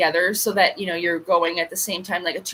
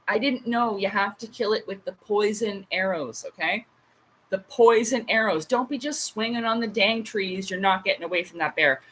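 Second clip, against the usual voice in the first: ordinary talk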